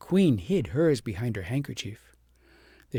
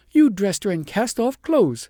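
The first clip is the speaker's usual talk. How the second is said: higher, louder, accusing; accusing queen; 7 semitones higher, 7 dB louder than usual. A narrator's reading. Queen accuses the shepherdess, high and loud. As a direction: throw the blame at her. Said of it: "said the queen".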